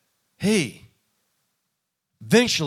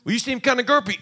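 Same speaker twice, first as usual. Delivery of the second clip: mock accusing; 8 semitones higher, 3 dB louder, faster than usual. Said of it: "I've had people say".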